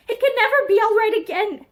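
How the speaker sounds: high, loud voice broken by sobs; words breaking under sobs; broken sobbing. Acted. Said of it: "her voice broken with sobbing".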